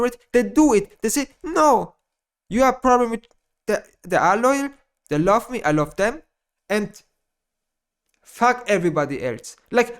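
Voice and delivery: ordinary delivery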